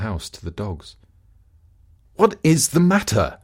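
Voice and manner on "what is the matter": calling out. Acted. Husband calls out.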